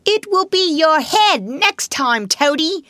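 high, loud, drawn-out jeer; taunting call. A narrator's reading. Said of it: "they called out to him".

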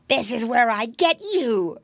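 pouncing fox, pitched a little up; mildly menacing fox voice; crying fox. A narrator's reading. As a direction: cry out like a storybook fox catching prey. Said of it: "cried the fox".

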